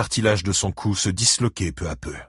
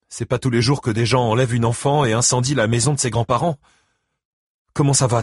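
higher, insistent woman's voice; concerned ex-wife; 5 semitones higher, 4 dB louder, faster than usual. Acted. Christine asks how Buddy Lee is coping, high and concerned. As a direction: press him about the news.

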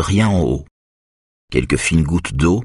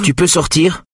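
curt tone; 12 semitones higher than usual. A narrator's reading. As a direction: snap the question at her to get out. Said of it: "d'un ton cassant".

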